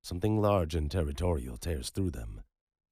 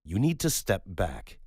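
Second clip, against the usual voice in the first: higher, louder command to back off; sharper warning; 6 semitones higher, 6 dB louder than usual. Acted. Roman warns the woman to step back, sharp and loud. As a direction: snap it as a firm order. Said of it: "Roman said, sharper now".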